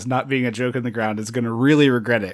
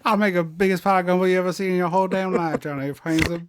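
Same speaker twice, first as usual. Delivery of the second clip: mock New Orleans accent; 6 semitones higher, faster than usual. Heroine boasting to Johnny, mock New Orleans accent. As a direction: run it out in a hammed-up Southern accent.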